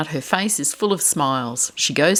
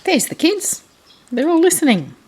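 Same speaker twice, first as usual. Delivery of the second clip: excited pointing; 12 semitones higher, 3 dB louder than usual. Real, not acted. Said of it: "reader points at picture".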